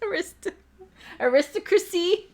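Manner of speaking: Aristocracy in a high put-on voice; high silly voice. Shown as a demonstration.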